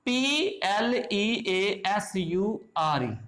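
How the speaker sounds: slow letter by letter spelling; spelling out slowly. Shown as a demonstration. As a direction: spell each letter deliberately. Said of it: "For example".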